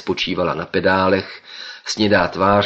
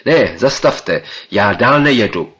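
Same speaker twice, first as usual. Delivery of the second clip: louder, firmer refusal; energetic refusal; 3 semitones higher, 7 dB louder than usual. Acted. Narrator refuses the professor, loud and firm. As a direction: refuse flatly and forcefully. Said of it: "odpověděl jsem energicky".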